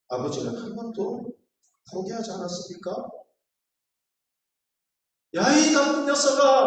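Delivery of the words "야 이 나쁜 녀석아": loud, higher, sharp rebuke; scolding father. Retold or imitated. Father yelling at his misbehaving son, loud. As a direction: bark it like a scolding dad.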